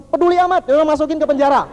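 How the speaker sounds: high, loud dismissive outburst; angry king. Acted. King dismissing adviser's plea, angry. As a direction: cut the adviser off with an angry order. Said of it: "rajanya marah".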